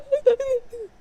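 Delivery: high, loud mock sob; mock emotional gasp. Reader showing how a book got to them, hammed-up high sob. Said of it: "I was like".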